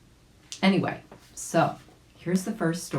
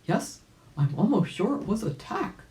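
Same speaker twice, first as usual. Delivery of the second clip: ordinary delivery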